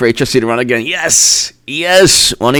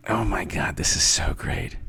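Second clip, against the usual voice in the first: low whispered delight; soft giddy whisper; 8 semitones lower, 12 dB quieter than usual. Real, not acted. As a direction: whisper it to yourself.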